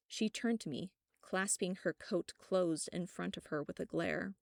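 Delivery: usual speaking voice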